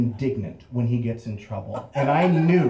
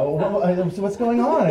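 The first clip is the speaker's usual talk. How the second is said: higher-pitched mock cluelessness; feigned innocence; 7 semitones higher, 5 dB louder than usual. Teen heads up to the cops, pretending he has no idea. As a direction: play dumb, stumbling into a high question. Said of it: "pretended like I had no idea".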